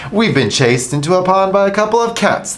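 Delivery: high, loud whiny complaint; moaning dogs. A narrator's reading. Dogs in the pond, high whiny moaning. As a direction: moan in embarrassment. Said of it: "they moaned".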